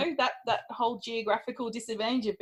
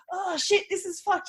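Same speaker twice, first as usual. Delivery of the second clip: mock panic; 8 semitones higher than usual. Baker panicking at ruined demo.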